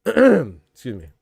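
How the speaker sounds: loud throat clear, then excuse me. Real, not acted. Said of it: "I'm stuffed up right now".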